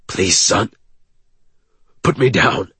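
lightly voiced pleading; lightly voiced older man pleading to be put down; a hint of a choking older man. A narrator's reading. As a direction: ask gently, short of breath. Choking man begs to be released.

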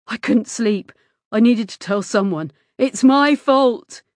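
increasingly loud, anguished admission; distraught confession. A narrator's reading. Distraught boy confesses his guilt.

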